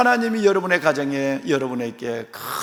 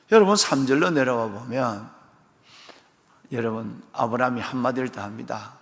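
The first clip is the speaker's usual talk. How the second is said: normal talking voice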